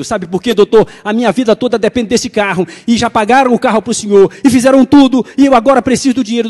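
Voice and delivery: rising to a loud, high, desperate plea; frightened woman pleading for her money; frantic plea. Retold or imitated.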